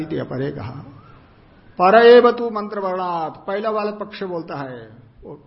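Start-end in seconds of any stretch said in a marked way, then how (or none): 1.8-3.5 loud, raised text-reading voice; projected Sanskrit quotation; reciting scripture. Real, not acted. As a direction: announce the Sanskrit line loudly, higher pitched.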